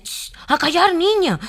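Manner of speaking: a hush, then high-pitched order; scolding nun. A narrator's reading. Nun silencing a girl in the dormitory, scolding. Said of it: "La madre dice".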